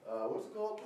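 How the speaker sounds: ordinary delivery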